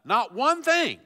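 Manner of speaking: loud, high preaching cry; preaching shout. Real, not acted. Preacher shouting to his church, emphatic. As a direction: drive it home to the congregation.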